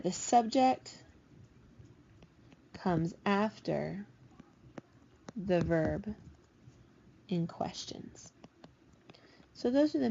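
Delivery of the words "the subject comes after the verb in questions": slow rule. Shown as a demonstration. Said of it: "teacher slows the rule, fading".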